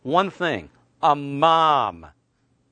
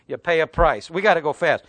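normal talking voice

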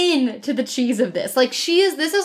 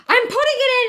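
put-on high baby voice; 11 semitones higher than usual. Baby voiced in retold scene.

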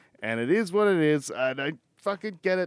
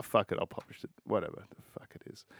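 under his breath; 5 semitones lower, 8 dB quieter, faster than usual. Real, not acted. Giving up on publishing doubts.

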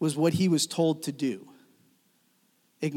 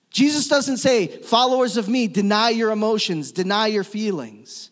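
raised, forceful pulpit voice; impassioned preaching; 4 semitones higher, 3 dB louder than usual. Real, not acted. Preacher refuting a false command of Jesus, impassioned. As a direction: ring it out high and loud to the room.

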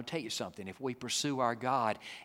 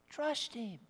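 soft plea; 10 semitones higher, 6 dB quieter than usual. Real, not acted. Soft repeated exhortation.

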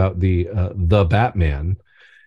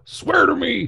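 gravelly Batman voice; slower than usual. Shown as a demonstration. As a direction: growl it like a brooding vigilante. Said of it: "you just gravelly voice talk".

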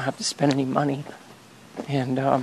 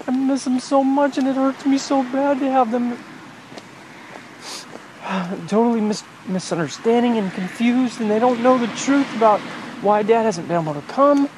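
higher, strained voice of real grief; heartbroken; 9 semitones higher than usual. Real, not acted. Father missing his children, voice rising and strained with grief. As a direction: let the hurt push the voice up. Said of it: "My heart is breaking for my children".

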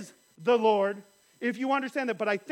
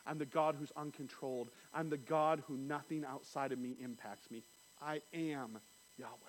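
hushed voice of God; 9 semitones lower, 13 dB quieter than usual. Acted. God quietly assuring Moses in the preacher's voice. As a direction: speak it as a quiet vow.

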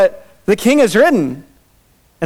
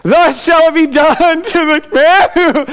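mock grand announcement, higher, louder and slow; forced proclamation; 10 semitones higher, 4 dB louder than usual. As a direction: proclaim it big while choking back tears. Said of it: "he's gotta believe it".

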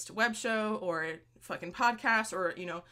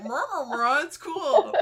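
mumbling through a mouthful of water, laughing; mouth full; 7 semitones higher, 8 dB louder, slower than usual. Retold or imitated. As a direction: imitate talking with water in mouth. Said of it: "That was me going like".

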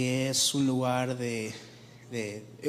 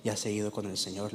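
low, hushed news of a death; grieving nurse; 5 semitones lower, 5 dB quieter, faster than usual. Acted. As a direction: break the loss softly. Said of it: "está llorando".

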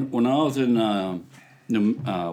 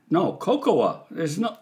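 normal talking voice

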